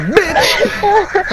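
mock high voice. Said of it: "He's like".

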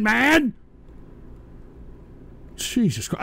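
usual speaking voice

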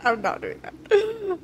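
high, slow laughing protest; laughing high refusal. Real, not acted. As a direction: squeal through laughter.

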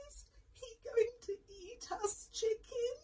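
high trembling squeak; squeaky fear. Acted. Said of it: "squeaked Jack, shaking with fear".

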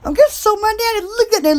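ordinary talk, nothing marked

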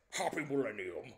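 imitated film star's catchphrase; Sean Connery. Quoting a corny film kiss line, actor impression. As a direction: deliver it as a recognisable actor impression. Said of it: "Sean Connery lines ... he's like".

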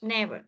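Never with an open e; vowel demo. Shown as a demonstration. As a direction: say Never as a model, e in the first syllable. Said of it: "Nó phát âm là e".

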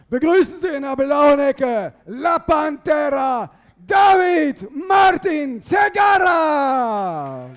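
high, slow, booming name call; drawn-out announcer shout. Acted. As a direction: stretch the name out to the crowd. Ring announcer calls boxer's name.

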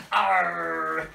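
long drawn-out pirate "arr"; pirate growl. Pretend. Entertainer playing pirate for children, drawn-out growl. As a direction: hold it like a pantomime pirate. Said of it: "Do I look like a real pirate now?".

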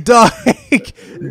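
loud, high, very slow scolding; mock scolding. Telling off the Twitter dude, slow loud mock scold. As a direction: drag out the insult, laughing at him. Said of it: "I'm like".

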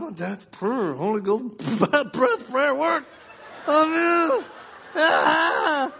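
high-pitched, laughing through the words; cracking up. Real, not acted. Son telling his wife the silly prayer worked, cracking up. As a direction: let the words climb and collapse into laughter.

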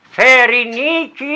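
slow, high called-out name; calling her name. Acted. The father spots his lost daughter and calls her name, slow and high. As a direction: call the name out across the forest. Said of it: "Το όνομα της φώναξε".

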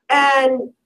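normal talking voice

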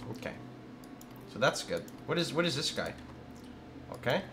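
ordinary talk, nothing marked

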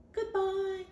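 high, light little-dog voice; a squeaky cartoon dog saying goodbye. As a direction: pipe it up high like a small dog. Second dog answers goodbye.

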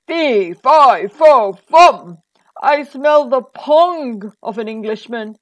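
loud booming giant's roar; big, loud storybook giant. A narrator's reading. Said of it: "roared the giant".

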